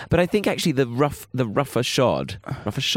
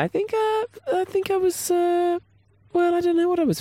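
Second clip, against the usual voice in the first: squeaky confused voice. Pretend.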